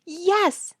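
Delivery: high, delighted yes; a child bubbling over; gleeful little yes; giggling with delight. A narrator's reading. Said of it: "giggling with delight".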